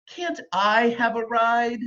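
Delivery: put-on high, pleading child. A narrator's reading. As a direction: whine for a ride. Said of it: "the prince cried".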